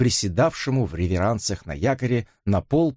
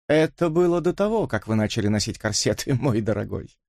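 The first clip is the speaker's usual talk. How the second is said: mocking Elisa. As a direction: needle him sweetly. Elisa mocks Dipps. (насмешливая дама со смешком)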